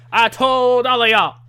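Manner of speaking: cocky wrestler promo. Retold or imitated. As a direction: brag to the whole arena. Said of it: "Ernest Miller says".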